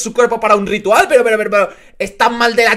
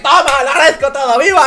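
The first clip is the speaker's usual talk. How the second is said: cheering; 6 semitones higher, 5 dB louder than usual. Real, not acted. Celebrating subscribers.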